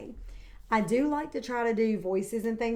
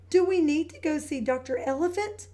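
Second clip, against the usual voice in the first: put-on high, anxious child voice; worried little pig; 6 semitones higher than usual. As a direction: ask it like a worried little girl. Peppa worried about tooth.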